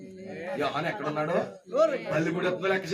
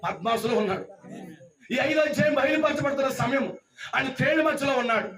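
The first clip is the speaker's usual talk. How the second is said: raised, driving preacher's voice; forceful preaching; 6 semitones higher than usual. Real, not acted. Preacher describing John among scorpions and serpents, forceful preaching. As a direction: build the peril phrase by phrase.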